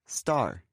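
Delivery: STAR said alone, falling, stress on the A; stressed vowel. Shown as a demonstration. Teacher showing where one-syllable star is stressed, stressed vowel. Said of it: "one stress, over the A".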